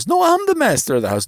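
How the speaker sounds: high, loud, fast protest; indignant insisting. Acted. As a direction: insist it's his house. Rich man insists.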